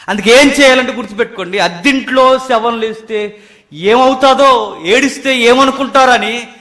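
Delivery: loud, high preaching surges; full-force preaching. Acted. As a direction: drive the point home to the crowd.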